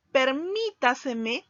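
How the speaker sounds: "permítaseme" said slowly with MÍ drawn out; slow down and lean on MÍ in "permítaseme"; stress on fourth-to-last syllable. Shown as a demonstration. Teacher demonstrating a sobresdrújula, slowly stressing MÍ in "permítaseme". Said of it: "el acento recae en posición cuatro".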